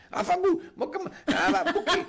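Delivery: high-pitched mock grumbling; borbottio stizzito dopo la tosse. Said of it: "ogni volta alla fine della canzone un". (borbottio arrabbiato per finta)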